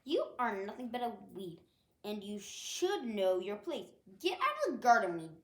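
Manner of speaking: a queen putting a weed in her place, shouting; Queen Tulip yelling. Acted. Queen Tulip yells at Dandy for disturbing them, loud and regal. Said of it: "Queen Tulip yelled".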